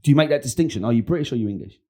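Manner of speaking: ordinary delivery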